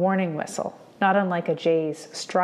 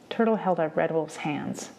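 ordinary delivery

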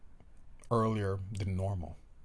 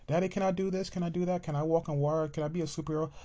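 put-on child voice; 8 semitones higher, faster than usual. Child pestering daddy, high and eager. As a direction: rattle off questions like an excited child.

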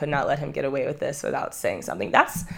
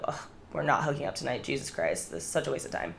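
annoyed mutter; 2 semitones lower, 10 dB quieter than usual. Retold or imitated. Annoyed at drunk boyfriend.

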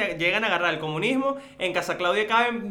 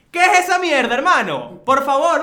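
much higher and louder, fed-up outburst; outraged rant; 8 semitones higher, 9 dB louder, slower than usual. Real, not acted. Friend ranting to his cohost about useless candles, loud outraged outburst. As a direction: blow up about the junk candles.

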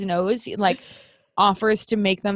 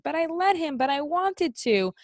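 higher and slowing, sticking up for big brother; pleading little brother; 7 semitones higher than usual. Retold or imitated. Little brother pleading to parents, retold.

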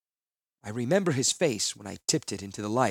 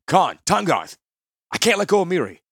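loud, higher voice of a desperate man; desperate Gerard; 7 semitones higher, 10 dB louder than usual. Acted. Gerard begging his shipmates for help, a loud desperate cry. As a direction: call for help while holding the wounded.

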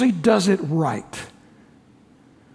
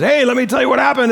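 fast, louder, higher excited telling; eager blabbing; 5 semitones higher, 4 dB louder, faster than usual. Acted. Leper bursting to tell everyone at the pub, excited. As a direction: burst out eager to share the news.

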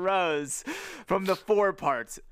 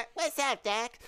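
higher-pitched cartoon rabbit voice; terrible Bugs Bunny; 7 semitones higher, 3 dB quieter than usual. Host quoting the carrot-eating rabbit, poor cartoon impression. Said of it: "Which is a terrible Bugs".

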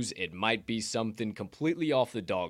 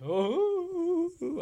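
long high-pitched excited whoo; excited "ooh". Real, not acted. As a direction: let out a rising, high hoot. Excited whoop before the show.

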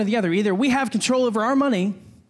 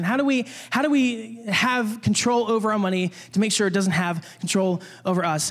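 ordinary talk, nothing marked

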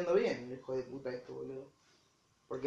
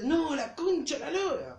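angry outburst; 11 semitones higher, slower than usual. Retold or imitated. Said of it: "Pero mucho más fuerte".

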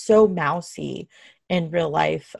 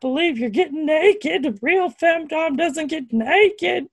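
put-on Southern accent; 8 semitones higher than usual. Femdom critics gasping over a model's nudity, mocking Southern accent. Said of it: "they have a Southern accent".

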